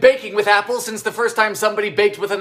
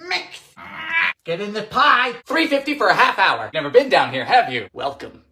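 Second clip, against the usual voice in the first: ordinary talk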